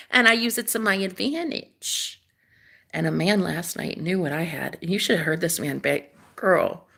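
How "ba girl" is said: ordinary talk, nothing marked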